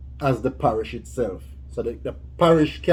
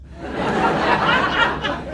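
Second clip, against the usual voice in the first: breathy high-pitched laugh. Real, not acted. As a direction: burst out laughing. Laughing at her own answer.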